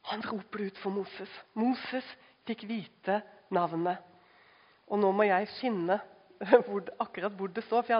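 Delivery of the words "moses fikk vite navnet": ordinary talk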